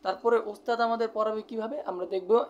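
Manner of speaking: ordinary talk, nothing marked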